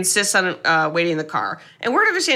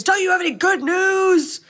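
a worn-out father screaming at his business partner; 11 semitones higher than usual. Retold or imitated. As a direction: snap and scream it high. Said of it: "And George screams".

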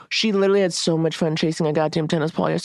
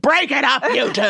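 imitating a bossy dog, pitched up; barking dog scolding; 7 semitones higher than usual. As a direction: break up a fight as the household narc. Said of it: "She will literally start barking".

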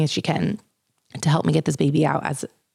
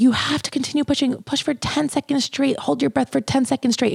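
higher, faster coaching orders; urgent nurse coaching; 7 semitones higher than usual. Retold or imitated. Nurse urging her to keep pushing, urgent and higher. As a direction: drive her on like a delivery nurse. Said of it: "they're like".

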